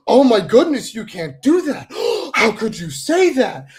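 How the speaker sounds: mock horror at what was said; mock scandalized critic. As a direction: ham up a clutching-pearls critic, all shock and scolding. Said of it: "someone goes".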